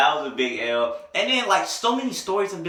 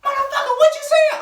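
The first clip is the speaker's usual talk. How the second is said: very high-pitched screech, loud at the end; mock outraged girl; 7 dB louder than usual. Girl confronts him over his poem, high mock outrage. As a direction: imitate an indignant girl shrieking.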